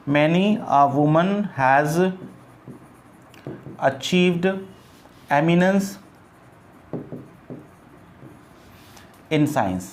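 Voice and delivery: very slow, word by word; teacher dictating to the class; slow dictation. Shown as a demonstration. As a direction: dictate Many a woman has achieved eminence in science with long pauses. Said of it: "dictating the corrected sentence".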